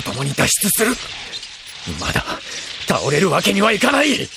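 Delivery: grit through, refusing to fall; determined. Acted. Pilot's defiant resolve. (決意を込めて強く)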